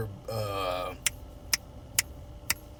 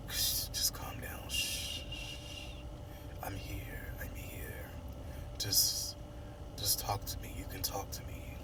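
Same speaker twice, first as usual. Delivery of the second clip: soft hushed whisper, soothing; tender whispered reassurance; whispered comfort; 6 semitones lower, 14 dB quieter than usual. Retold or imitated. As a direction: hush her gently, like calming someone crying. Comforting crying Eva.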